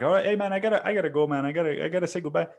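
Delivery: high, hurried phone sign-off; mock-flustered, repetitive rush to hang up; flustered goodbye. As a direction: imitate someone scrambling to end a call. Flustered caller hastily ends call.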